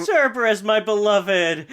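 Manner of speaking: gushing high and loud over the dog; doting Hades. Retold or imitated. Hades greeting his dog, adoring and high. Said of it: "He's like".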